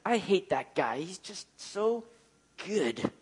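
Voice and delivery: soft, slow, grudging mock resentment; quietly imitating someone envious of a good man; jealous grumble. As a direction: mutter it like a jealous churchgoer. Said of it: "it's like".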